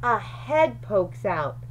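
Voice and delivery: ordinary delivery, nothing marked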